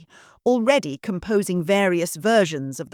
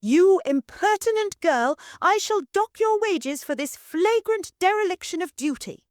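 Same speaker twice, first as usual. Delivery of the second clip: very high, shrill-pitched commanding matron; imperious mistress; 13 semitones higher than usual. Acted. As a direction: order the maid about from on high. Mistress scolds maid.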